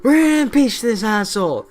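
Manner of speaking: hammed-up Congress losing patience; furious Congress. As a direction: Congress fed up and vowing to impeach the President. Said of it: "they're like".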